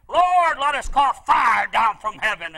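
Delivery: indignant shout. Retold or imitated. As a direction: demand fire in anger. Disciples demanding fire on Samaria.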